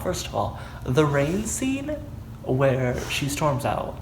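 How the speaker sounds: ordinary talk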